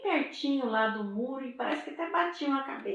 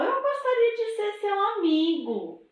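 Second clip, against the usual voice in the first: high, louder friendly offer; friendly boy; 10 semitones higher, 5 dB louder than usual. Acted. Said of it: "e ele falou". (menino oferecendo amizade com voz aguda e alta)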